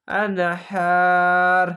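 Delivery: আনহার drawn out on "haa", ending on r; stopping form. Shown as a demonstration. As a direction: chant আনহার slowly, no vowel after the r. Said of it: "এভাবে হবে".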